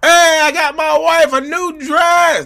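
loud, high, slow bragging yell; hammed-up high boasting holler; mock bragging shout. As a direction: shout it to the rooftops like a proud show-off.

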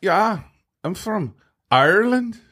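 high-pitched mock Irish accent; Irish accent that wobbles toward Scottish; put-on Irish accent. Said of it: "it's a great accent".

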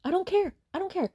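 high-pitched, exasperated repetition; emphatic dismissal. Real, not acted. Reviewer to viewers about the book's ending, exasperated dismissal. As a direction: jump up high and insist on it.

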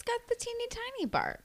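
high mock tiny-dog voice; teeny tiny voice. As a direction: shrink the voice to match a tiny dog. Mimicking a tiny dog's bark.

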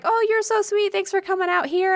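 high, sweet retold gush; gushing thanks. Voicing sweet actress thanking fan.

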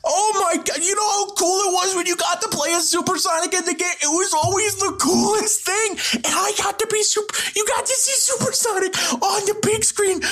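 high, excited gushing; overjoyed. Real, not acted. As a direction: gush like a fan close to tears. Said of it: "I'm crying right now".

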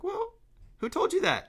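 squeaky high "who told you that?"; high disbelieving voice; high incredulous challenge. Retold or imitated. Mocking the limiting belief.

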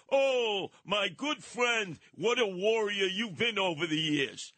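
slow, syrupy mock praise; Eric Adams gushing. Retelling the mayor's call to his old rival, in a hammed-up gushing voice.

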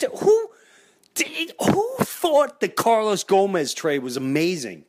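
high-pitched indignant fan rant; rant, pitch climbing with outrage; escalating comedy rant. Real, not acted. Fan rants about bad trade.

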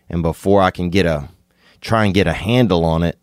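ordinary talk

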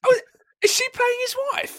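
very high, disbelieving question; high astonished query; shocked. Real, not acted. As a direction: gasp it out in shock. Shocked at casting.

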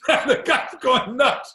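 high, loud burst of real laughter that spills into the words; laughing. Real, not acted. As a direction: laugh it out, then say the line slowly. Laughing while telling the story.

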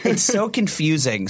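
normal talking voice

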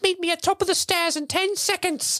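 high squeaky elf orders; Dobby voice. Retold or imitated. Dobby telling Harry where to meet, high elf squeak. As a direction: chirp it fast like a cocky elf. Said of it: "and he's like".

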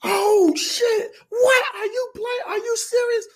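high, loud, crying fan squeal; screaming fan. Retold or imitated. Fan at the gate recognizing him, shrieking. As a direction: shriek in disbelief at meeting him. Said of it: "And she's like".

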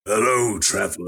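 a hint of a deep undead king; lightly voiced ancient rasping king; Lich King voice. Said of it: "You don't have to do the voice".

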